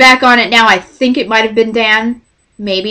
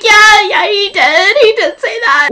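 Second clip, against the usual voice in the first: high, anxious agreement; cling to the reassurance in a squeaky voice; mock fangirl whimper; freaking out; 10 semitones higher than usual. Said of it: "freaking out on the phone".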